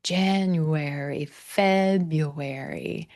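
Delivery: January and February slow, first syllable stressed. Shown as a demonstration. Coach models the words for students, slow with first-syllable stress. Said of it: "I'm hearing that beautiful stressed syllable".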